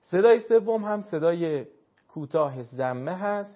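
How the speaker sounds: ordinary talk, nothing marked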